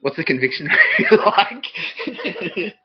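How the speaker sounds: giggling question; laughing. Real, not acted. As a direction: ask it cracking up.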